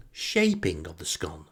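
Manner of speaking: usual speaking voice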